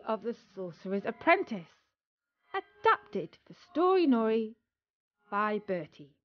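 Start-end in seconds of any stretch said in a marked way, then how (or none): none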